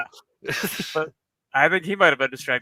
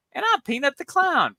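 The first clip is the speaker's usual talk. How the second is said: high squeaky clown voice; 11 semitones higher than usual. Retold or imitated. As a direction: imitate a silly clown, pitched way up. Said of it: "she's doing her clown voice".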